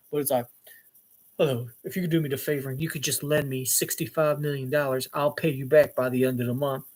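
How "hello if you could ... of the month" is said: low, smooth, deep con-man voice; persuasive put-on voice. Man asks for a huge loan, low persuasive voice. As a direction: lower the voice to sound trustworthy. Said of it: "people will believe me if I talk a certain way".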